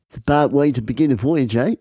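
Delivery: quick mock Australian, rising tag; Australian accent. Tall man warns fellow passenger about the voyage, Australian accent. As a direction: botch the Scottish into broad Australian. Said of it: "it's gone so Australian".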